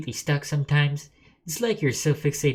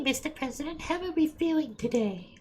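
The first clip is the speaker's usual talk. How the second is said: very high put-on falsetto; Miss Allison; 12 semitones higher, 3 dB quieter than usual. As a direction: play a self-improvement coach in a high-pitched voice. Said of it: "I can't do that high-pitched voice".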